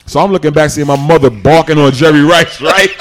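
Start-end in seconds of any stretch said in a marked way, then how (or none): none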